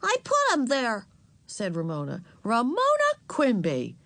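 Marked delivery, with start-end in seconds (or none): 0.0-1.1 put-on high little-girl voice; small child. A narrator's reading. Ramona admitting it to Henry, high child voice. 2.4-4.0 higher, reproving full-name call; exasperated. A narrator's reading. Mother reproving her daughter, exasperated. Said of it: "Even Mother sounded exasperated".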